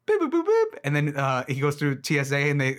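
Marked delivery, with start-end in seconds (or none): 0.1-0.8 high, loud chirpy robot beeping; robot beeps. Retold or imitated. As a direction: beep it out like a cartoon robot. Imitating robot noises.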